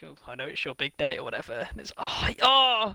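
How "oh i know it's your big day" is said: lightly voiced mumbling Mister Bean; a hint of Mister Bean; mock Mister Bean voice, a touch lower and softer; Mister Bean impression. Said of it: "in that weird Mister Bean accent".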